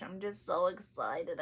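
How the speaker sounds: ordinary delivery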